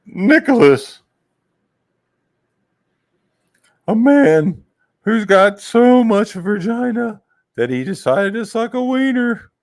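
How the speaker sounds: high-pitched, drawn-out mocking impression; slow, loud falsetto caricature; mock him in a squeaky high voice; Nicholas. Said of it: "he sounds like that".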